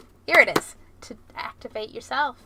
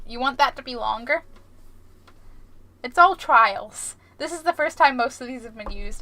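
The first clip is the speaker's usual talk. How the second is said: ordinary talk, nothing marked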